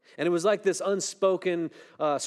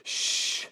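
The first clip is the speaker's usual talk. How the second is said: hushing shush. Retold or imitated. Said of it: "God is saying to us".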